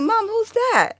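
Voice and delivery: high-pitched question to mom; retold curious question. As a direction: ask it high, at no extra volume, as a retold line.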